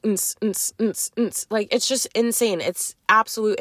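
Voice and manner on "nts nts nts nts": mouth-imitated club bass thump, soft; beatboxing the club music thumping in the background; club beat. To her friend, imitating the club music through the noise: soft beatbox thump. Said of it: "all the fucking noise in the background is like".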